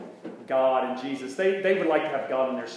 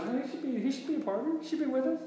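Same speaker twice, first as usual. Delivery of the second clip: voiced much higher as Don, a bit softer; Don's retold line; 11 semitones higher, 3 dB quieter, faster than usual. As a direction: lift the pitch way up to play Don insisting. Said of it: "and Don goes".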